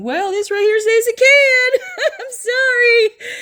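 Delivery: high, laughing triumphant correction; gleeful contradiction. Real, not acted. Preacher contradicting those saying nobody can know, gleeful high correction. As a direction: swing up high and laugh through the rebuttal.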